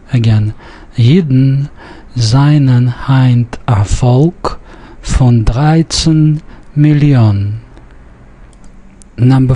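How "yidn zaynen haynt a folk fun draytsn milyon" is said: slow, word-by-word reading with Millionen stressed on its last syllable, "mili-ON"; short I as in fine in seinen, Heint, dreizehn; Yiddish pronunciation model. Shown as a demonstration. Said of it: "it falls on the last syllable".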